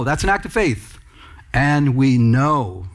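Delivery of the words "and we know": "And we know" drawn out slowly; emphatic slow restatement. Shown as a demonstration. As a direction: stretch each word of "And we know" with firm weight. Restates the verse's key words emphatically.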